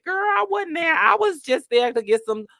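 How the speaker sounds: high, innocent excuse voice; mock excuse. Mocking bookstore regulars, high innocent excuse. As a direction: play the innocent customer. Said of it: "Everybody can say".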